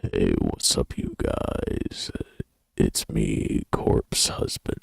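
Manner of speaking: deep creaky whispery voice; low gravelly creak, slow; [name] voice. Shown as a demonstration. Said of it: "I sound like a, uh, corpse husband".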